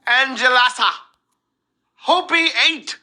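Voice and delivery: slow, high, drawn-out name call; announcer. Acted. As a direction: announce each winner with flair. Reads out winners' names.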